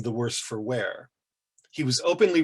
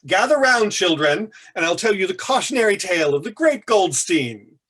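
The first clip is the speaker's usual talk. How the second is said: louder and higher, calling the room to gather; raised voice; 7 semitones higher, 6 dB louder than usual. Acted. Paul calls the students in the lounge to listen, raised and theatrical. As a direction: summon an audience like a showman. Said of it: "He raised his voice".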